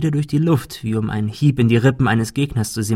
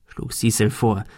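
usual speaking voice

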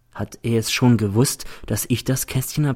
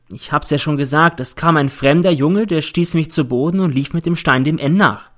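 put-on higher-pitched young witness, insistent; girl insisting on her account; 5 semitones higher than usual. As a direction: insist on your story as the girl. Girl repeats her testimony. (Mädchen, beharrlich aussagend)

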